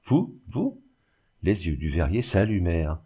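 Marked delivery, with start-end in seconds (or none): 0.1-0.8 high, rising astonished question; incredulous. A narrator's reading. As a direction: ask in disbelief, each word leaping up. Invalid amazed at her promise. (incrédule, stupéfait)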